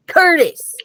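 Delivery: name spat out high and loud. Acted. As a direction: spit the name out in fury. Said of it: "Hashtag practically spat".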